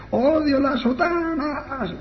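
very high, slow demonic wail; imitated possessed scream. Possessed girl rails at cassocks, in an imitated high scream. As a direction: stretch the vowels into a high mock scream. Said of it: "gritando con esa voz".

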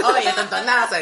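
loud laughing call-out; shouting. Retold or imitated. Quoting drunk insult to aunt.

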